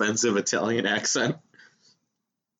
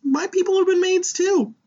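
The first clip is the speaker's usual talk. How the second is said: much higher and louder, mock outrage; mock indignant shout; 5 dB louder than usual. Real, not acted. Cutting in on a friend's joke about maids, mock indignant. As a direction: shout it out in fake protest.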